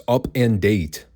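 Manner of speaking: UP and DATE slow, each set apart; stressed separate words. Shown as a demonstration. Host pointing out a spelling blunder, stressed "up" and "date". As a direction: lean deliberately on "up" and on "date". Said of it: "I do stress the words".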